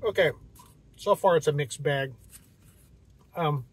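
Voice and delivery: chewing between words; talking mid-bite; mouth partly full. Real, not acted. Seen 0: Bitten sandwich in hand; closed-mouth chewing pauses between early words.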